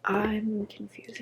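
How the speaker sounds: confused whisper to herself. Real, not acted. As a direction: whisper it, half to yourself.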